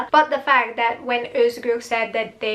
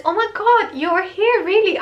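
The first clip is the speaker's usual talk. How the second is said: high-pitched mock astonishment; surprised greeting; 8 semitones higher, 3 dB louder, faster than usual. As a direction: act out a delighted surprise encounter. Acting out Serdar's surprised greeting.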